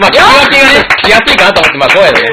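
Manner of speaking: high, loud voice. Acted. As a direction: push the voice high and loud for the bit. Voice-distinguishing bit after low-voice introduction.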